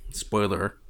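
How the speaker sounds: ordinary talk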